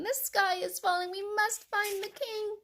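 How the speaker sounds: high, slowing flock alarm; squawking, clucking and quacking. A narrator's reading. Three birds dashing off together, squawking. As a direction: squawk it high like panicked poultry. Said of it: "squawking and clucking and quacking".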